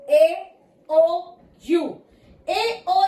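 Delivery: ए, ओ, यू ज़ोर से, अलग-अलग; हर वॉवल को ज़ोर देकर गिनाना; emphatic vowels. Shown as a demonstration. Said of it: "मैं इस चीज पे जोर दे रही हूं". (ज़ोर देकर बोले गए वॉवल)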